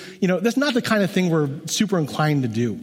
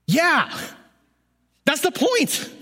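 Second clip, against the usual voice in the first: emphatic exclamation; 11 semitones higher, slower than usual. Real, not acted. Emphatic retort in sermon.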